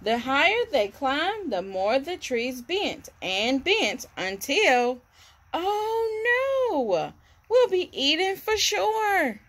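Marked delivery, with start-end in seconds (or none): 5.5-9.4 high panicked wail; frightened cry. Acted. Chimps at the treetops, high panicked cry. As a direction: cry out as if about to be eaten.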